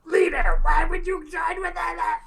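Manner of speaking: high, loud squeaky accusation; kobold shouting. Acted. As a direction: shriek the accusation at Trex. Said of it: "is like".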